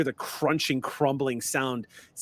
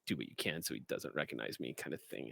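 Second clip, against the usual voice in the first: much softer, quick whispered aside; hushed aside; 13 semitones lower, 17 dB quieter, faster than usual. Acted. Ameep whispers aside to the party, hushed and quick. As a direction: tell the party secretly so he won't hear. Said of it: "Ameep turns and looks at all of you and goes".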